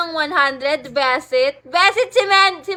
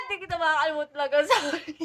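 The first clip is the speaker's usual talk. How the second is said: ordinary talk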